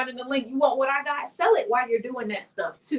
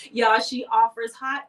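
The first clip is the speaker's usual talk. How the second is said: normal talking voice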